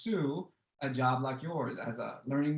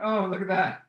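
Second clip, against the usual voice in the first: a louder, bright "oh, look at that" with a falling tune; surprised delight; 5 semitones higher, 9 dB louder than usual. Real, not acted. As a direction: react as the photo pops up. Reacting to the picture reveal.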